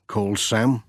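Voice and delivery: ordinary delivery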